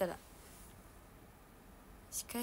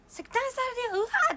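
very high, loud yelp of fright; startled shout; 11 dB louder, faster than usual. Retold or imitated. Imitating someone jolted by the warning, shouting. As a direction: jump and cry out in alarm. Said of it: "ってなっちゃうよね".

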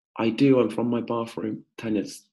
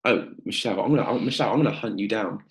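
ordinary talk

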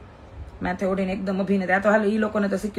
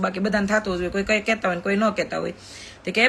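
ordinary talk, nothing marked

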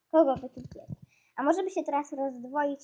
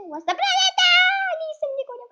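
very high, loud wail of outrage; alarmed shriek; 6 dB louder, faster than usual. Real, not acted. Player chased by her own planet, alarmed shriek. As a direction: shriek it high in playful panic.

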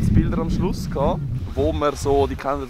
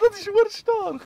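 lachend; 4 dB louder, slower than usual. Real, not acted. Lacht nach dem Spiel.

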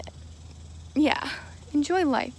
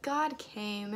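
ordinary delivery

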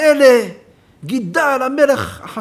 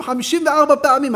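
ordinary delivery

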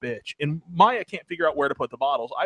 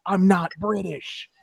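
defiant retort; 3 semitones higher, slower than usual. Retold or imitated. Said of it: "I got back in his face and went".

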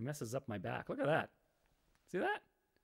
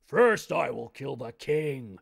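slow, louder, grim threat; menacing barbarian chief; 9 dB louder, much slower than usual. Acted. Barbarian chief announcing his judgment, slow and menacing. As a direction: lay down the threat slowly and heavily.

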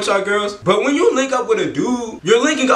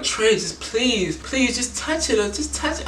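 put-on whiny begging girl; 3 semitones higher, 6 dB quieter than usual. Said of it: "like, whining some more".

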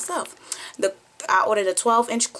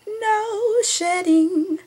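high and very slow, savoured; a high, drawn-out, thrilled no shedding; delighted; 13 semitones higher, much slower than usual. Real, not acted. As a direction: stretch it out in delight. Delighted at the hair.

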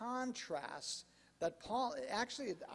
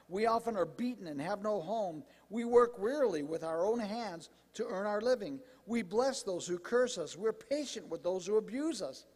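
emphatic scripture reading; 2 semitones higher, 5 dB louder than usual. Acted. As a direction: read the apostle's words out forcefully to a congregation. Reads scripture aloud to congregation.

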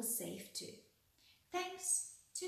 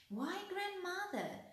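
put-on little girl's high-pitched exclamation; crying out; 9 semitones higher than usual. A narrator's reading. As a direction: exclaim in mild wonder, voice lifted. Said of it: "she cried".

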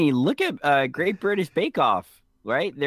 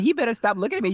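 higher-pitched retold threat; mock warning; 11 semitones higher, much faster than usual. Woman beside a staring gentleman, higher-pitched mock warning. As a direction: imitate her warning off an onlooker.

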